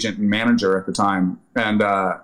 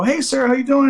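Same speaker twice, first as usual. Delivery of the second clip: chipper hello; 14 semitones higher, 3 dB louder than usual. Retold or imitated. Retold phone greeting, excited.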